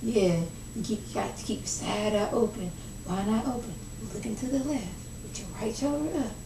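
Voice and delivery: hushed, cryptic riddle-speak like the Joker; riddling Joker. Retold or imitated. Mother whispering cryptic warnings to Scrappy, hushed and riddling. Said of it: "doing this riddle me this thing".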